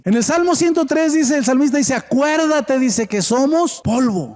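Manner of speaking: high, ringing scripture proclamation; preaching. Acted. Preaches at full force. (predicando, voz alta y aguda)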